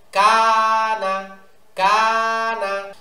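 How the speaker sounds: "কানা" with a long held কা and short না; long first syllable. Shown as a demonstration. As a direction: stretch the কা in "কানা", no stretch on না. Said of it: "কাতে আলিফ মাদ আছে".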